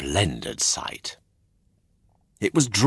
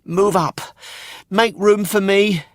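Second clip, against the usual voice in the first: high and louder, pushing in; eager puppet boy; 13 semitones higher, 4 dB louder than usual. A narrator's reading. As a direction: squeeze aboard. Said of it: "then he said".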